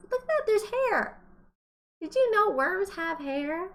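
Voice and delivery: high, excited look-at-that to the class; amazed discovery. Acted. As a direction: show the kids something amazing. Teacher shows worm hair.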